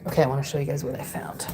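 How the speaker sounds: fast soft whisper. Real, not acted. Telling viewers about her find, fast whisper. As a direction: hush it to the viewers.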